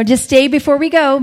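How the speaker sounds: ordinary talk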